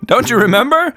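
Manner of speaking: usual speaking voice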